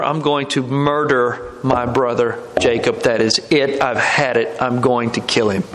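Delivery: low, measured vow of revenge; grim resolve. Retold or imitated. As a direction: retell a brother's murderous vow, low and controlled. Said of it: "he resolves in his mind".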